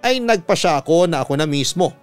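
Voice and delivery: ordinary delivery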